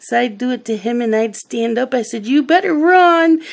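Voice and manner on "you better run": high, loud mock threat to a toddler; sing-out chase warning, pitched way up; playful warning. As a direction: tease a little one into fleeing.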